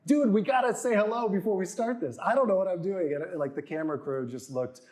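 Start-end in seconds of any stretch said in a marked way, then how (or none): 0.1-3.1 high-pitched re-enacted flustered outburst; flustered plea. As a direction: plead urgently, acting out being lost on camera. Acts out flustered plea to host.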